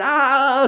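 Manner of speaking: high, loud "aah" of alarm; mock panicked yell. Rider thinking to himself mid-wheelie, mock panicked yell.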